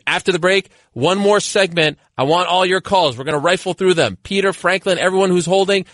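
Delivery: high-pitched, punchy host sign-off; radio break tease. Real, not acted. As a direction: urge listeners to stay through the break.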